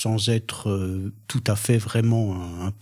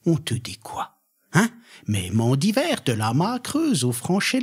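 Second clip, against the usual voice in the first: sound poetry reading; 3 semitones higher than usual. Acted. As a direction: declaim a nonsense-language poem, pitch leaping in wide arcs. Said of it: "lire, euh, un extrait de Zaoum".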